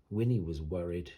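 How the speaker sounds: ordinary delivery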